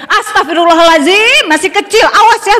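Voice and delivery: mock-shocked mother, rising to a laughing warning; hammed-up gasp of a startled mum; shocked scolding mother. Mother catching her child's crush, half-laughing warning. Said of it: "Jadi ibu jangan gini".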